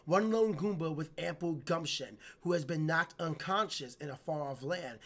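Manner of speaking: game intro narrated softly; story narration. A narrator's reading. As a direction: read it like a storybook opening. Reads game intro text.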